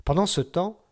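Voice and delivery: plain reading voice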